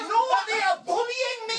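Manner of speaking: high, pitiful confession; whiny admission. Retold or imitated. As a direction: squeak it out sheepishly. Admitting bullying to hairdresser.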